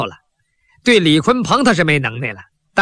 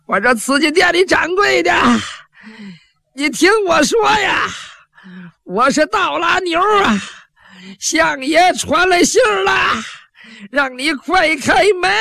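high, drawn-out yell, slowing at the end; loud calling shout; 11 semitones higher than usual. Acted. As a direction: bellow it so the shopkeeper hears. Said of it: "你扯开嗓子喊".

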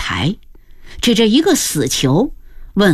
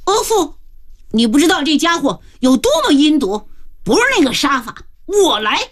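higher-pitched interjecting voice; character cutting in; 6 semitones higher, faster than usual. A narrator's reading. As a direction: step in and take over, pitched up. Character intervenes to take over killing. (拔高声调插话阻拦)

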